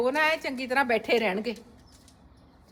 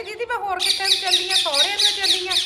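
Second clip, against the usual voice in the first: high, louder put-on whiny voice; mock Rekha reply; 8 semitones higher, 5 dB louder than usual. Rekha answering her sister-in-law, mock whiny high voice. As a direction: play the girl protesting, pitched up.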